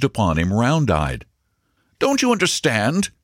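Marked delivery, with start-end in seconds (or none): 2.0-3.1 impatient question pitched higher; fidgety and pressed for time; restive. Acted. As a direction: broker impatiently pressing the stenographer, higher and restless. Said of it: "said Maxwell restively".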